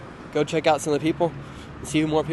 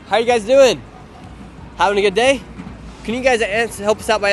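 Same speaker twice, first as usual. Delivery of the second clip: louder, upbeat street-host hello; loud greeting; 5 semitones higher, 5 dB louder than usual. Real, not acted. Vlogger greeting a man on the street, loud and upbeat. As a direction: call out cheerfully to a passerby.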